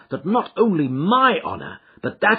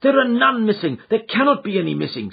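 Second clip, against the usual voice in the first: indignant protest; 8 semitones higher, 4 dB louder than usual. Acted. Son protests his innocence.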